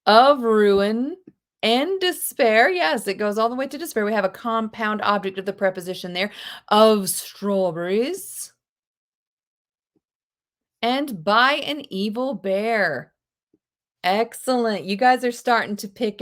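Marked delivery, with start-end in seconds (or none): 0.1-2.7 rising, drawn-out dramatic quote; dramatic reading. Acted. Teacher quoting a film line to class, dramatic. 6.7-13.1 slow, drawn-out quote; quoted movie line. Acted. Teacher quoting a film line, slow and stretched.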